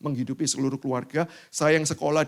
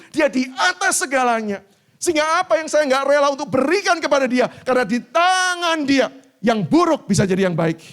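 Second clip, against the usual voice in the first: loud, high preaching voice; 12 semitones higher, 9 dB louder than usual. Acted. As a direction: preach it out over the crowd. Preaching at full force. (khotbah lantang dan tinggi)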